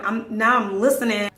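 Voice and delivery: ordinary delivery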